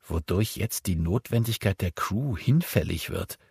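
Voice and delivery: plain reading voice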